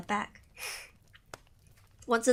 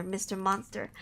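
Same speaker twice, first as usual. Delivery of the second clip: ordinary talk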